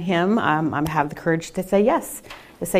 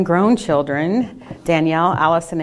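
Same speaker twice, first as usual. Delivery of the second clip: usual speaking voice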